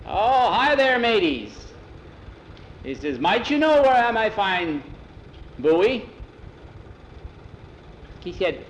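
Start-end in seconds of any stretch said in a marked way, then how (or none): 0.1-1.7 high, loud seafarer's hail; booming, drawn-out captain's hello; hearty sea captain. A narrator's reading. Sea captain hails the boys. 3.2-6.1 high, loud seafarer's hail; hearty sea captain. A narrator's reading. Sea captain asks for his son.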